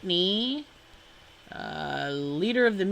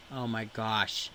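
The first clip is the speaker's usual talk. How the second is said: deflated oh my gosh; 7 semitones lower, 8 dB quieter than usual. Real, not acted. Overwhelmed by the list.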